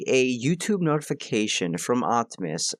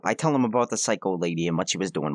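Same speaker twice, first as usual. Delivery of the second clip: ordinary talk, nothing marked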